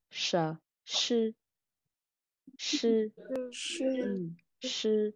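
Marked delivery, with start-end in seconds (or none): 0.1-3.1 "sư" and "sờ" with curled-tongue sh; heavy retroflex sh. Shown as a demonstration. Teacher models pinyin sh, heavy retroflex "sư". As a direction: curl the tongue up for "sư", "sờ". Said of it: "S H sẽ giống như chữ sờ".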